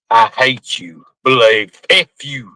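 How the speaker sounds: imitated curt messages, low-pitched; low mock-curt delivery, each phrase set apart; short sentences. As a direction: mimic someone firing off two-word texts. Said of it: "short sentences".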